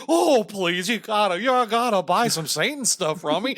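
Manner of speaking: loud, high-pitched mock pleading; hapless salesman. Salesman begs a customer to buy Satan stuff, mock pleading. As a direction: beg for the sale like a rumpled salesman. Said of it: "I actually see it like a guy".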